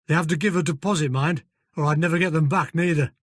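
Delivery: put-on broad northern English accent; gruff Yorkshire-style stable manager. Fergus voiced in northern accent.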